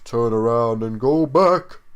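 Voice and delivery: loud, slow, rising warning; terrified warning. A narrator's reading. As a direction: build to an urgent, drawn-out warning. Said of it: "a hoarse terror in her voice".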